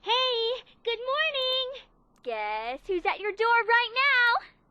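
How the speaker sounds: high, loud, stretched-out greeting; excited caller. Acted. Friend phones to announce a visit, high drawn-out excitement.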